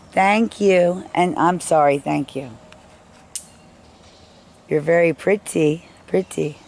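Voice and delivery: fond praise to tiny visitors; sweet thanks. Real, not acted. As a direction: gush gratitude at them.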